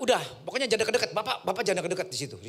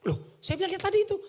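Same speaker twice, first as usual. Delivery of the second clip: surprised; 5 dB louder than usual. Retold or imitated. Narrator's surprised reply.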